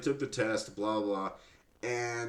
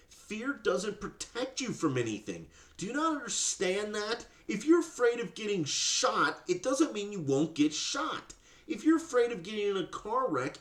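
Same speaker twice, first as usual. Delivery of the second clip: heated rant; 9 semitones higher than usual. Real, not acted. Ranting at the mother.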